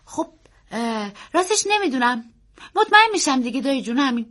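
put-on high, hesitant then hurried child voice; fidgety, impatient boy; restless boy. A narrator's reading. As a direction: fumble for an answer, then rush it out. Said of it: "پسر با بیقراری گفت".

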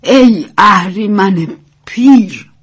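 slow, loud, vehement curse. A narrator's reading. Old woman cursing the flooding river, vehement. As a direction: hurl it out hard, stretching the words. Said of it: "پیرزن با لحنی شدید گفت".

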